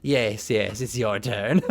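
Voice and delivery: low, soft goblin voice. Acted. Goblin telling someone it's their turn, low creature voice. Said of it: "the goblin's expression is just like".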